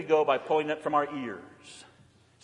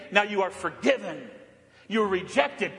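ordinary talk

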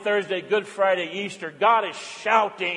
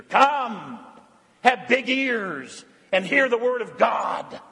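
calling out; 3 semitones higher, slower than usual. Real, not acted. Invitation to Holy Week services.

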